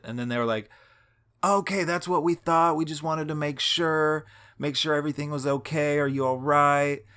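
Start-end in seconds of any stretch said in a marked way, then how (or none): 1.4-7.0 higher, louder, kindly homeowner voice; concerned homeowners. Retold or imitated. Homeowners checking on a stranger asleep in their driveway, higher and kind. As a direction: sound relieved and caring, pitched up.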